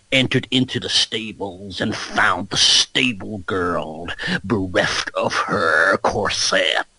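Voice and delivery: slow, low, drawn-out audiobook parody; Alex Jones. Pretend. Conspiracy host reading smutty Victorian audiobooks, slow low voice. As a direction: narrate smut as a deep, ominous ranting host. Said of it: "imagine Alex Jones doing a Victorian".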